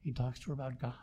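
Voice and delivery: normal talking voice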